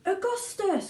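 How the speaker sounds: high anxious call; mother crying out. A narrator's reading. Mother crying out to her son, high. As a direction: call him like a fretful mother. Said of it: "cried Missus Gloop".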